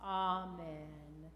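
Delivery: ordinary delivery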